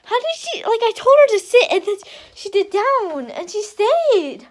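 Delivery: high, loud, squealing delight; thrilled, drawing out the last words; excited amazement. Real, not acted. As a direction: gush with proud excitement. Delighted the dog stayed down.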